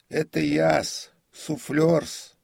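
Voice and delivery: put-on high, loud, slow servile old man; identify yourself in a high deferential voice; prompter's voice. A narrator's reading. Prompter explains himself to comic, high voice.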